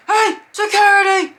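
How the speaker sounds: high, loud imitated shout; mock frantic holler; calling for security. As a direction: yell across a crowd for help. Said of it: "I hear".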